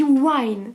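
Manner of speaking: wine said slowly in English. Shown as a demonstration. Explaining the logo to viewers, slow English wine. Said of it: "Comment on dit vin en anglais".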